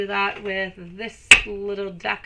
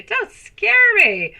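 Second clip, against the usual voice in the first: much higher, louder, slow hammed-up don't scare me; mock scared; 12 semitones higher, 8 dB louder, slower than usual. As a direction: ham up a fake fright, stretching it high. Reacting to a card drawn.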